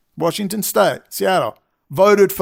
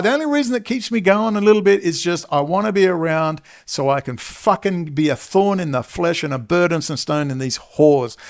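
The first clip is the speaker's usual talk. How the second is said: ordinary delivery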